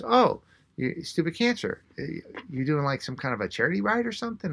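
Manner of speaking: lightly imitated curiosity, jumping high; mildly surprised stranger, lifting into a question; curious surprise. Cyclist spots logo on rider's arm, lightly imitated curious surprise. Voicing woman noticing the logo.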